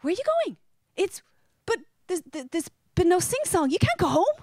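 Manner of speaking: Irish guest shocked the US wedding ends; dismayed protest. Retold or imitated. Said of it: "And then I'm like".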